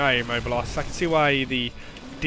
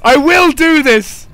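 much higher, loud, slowed frustrated yells; frustrated shouting; 10 semitones higher, 9 dB louder than usual. Real, not acted. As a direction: rage at failing the fight. Failing repeatedly, frustrated yelling.

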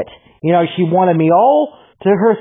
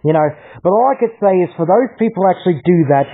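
ordinary delivery

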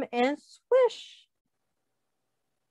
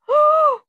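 sharp high gasp of alarm; 8 dB louder than usual. Acted. Reader to children, loud startled gasp. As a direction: gasp as if something scary appears. Reader reacts to big mouth.

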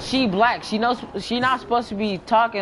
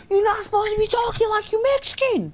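much higher, insistent protest; exasperated repeat; 12 semitones higher, faster than usual. Real, not acted. Player scolding the game character for talking Mexican, exasperated and high. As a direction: push the complaint up high in pitch.

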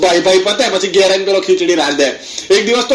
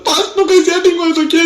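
high-pitched mock tearful plea; mock crying; 8 semitones higher than usual. Mourner asks for passes mid-grief, mock crying. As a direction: plead it in a put-on wail. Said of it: "રડ નહીં, રડ નહીં".

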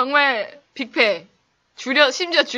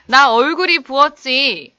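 example phrase; 3 semitones higher, 9 dB louder, slower than usual. Shown as a demonstration. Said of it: "영어로 어떻게 얘기하게요?".

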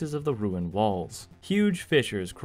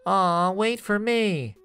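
high, slow nasal whine; 7 semitones higher, 3 dB louder, slower than usual. A narrator's reading. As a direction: pinch it through the nose, pleading. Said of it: "called after them in a very nasal voice".

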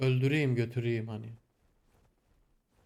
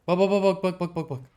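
excited urging; 6 semitones higher, 7 dB louder than usual. Real, not acted. Urging viewers to watch.

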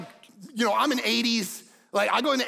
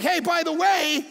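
voicing Jesus; 6 semitones higher, 3 dB louder than usual. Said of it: "Jesus is like".

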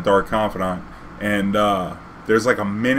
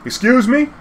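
much higher indignant rise; 13 semitones higher, 5 dB louder than usual. Retold or imitated. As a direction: excuse me with outraged disbelief. Retorting to judge, indignant.

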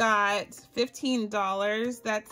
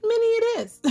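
lightly voiced self-quote, much higher; mock decisive self; 13 semitones higher, faster than usual. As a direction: chirp the decision in a high voice. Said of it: "I was like".

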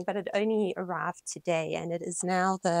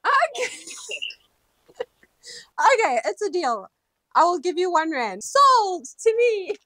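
much higher and louder, giddy; delighted squeal; 8 semitones higher, 9 dB louder than usual. Real, not acted. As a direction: laugh it off with giddy joy. Delighted agreeing to deal.

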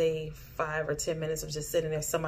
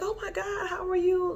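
surprised greeting; 14 semitones higher than usual. Retold or imitated. Greeting old classmate in dream.

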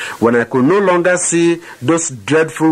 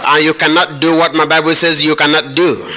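preaching at full force; 5 semitones higher than usual. Acted. Preacher leading the congregation against the devil, full-force proclamation. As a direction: proclaim it like a battle cry to the devil.

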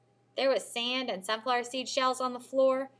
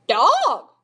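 loud, high parrot croak; 6 semitones higher, 11 dB louder than usual. A narrator's reading. Parrot perched on the dog's head, loud croak. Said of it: "she croaked".

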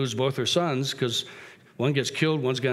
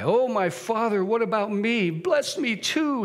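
high, drawn-out acted wail; pleading bitter cry; 8 semitones higher, slower than usual. Cheated son begging his father's blessing, acted bitter cry. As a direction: beg desperately, pitch climbing on slow words. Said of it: "he let out a loud and bitter cry".

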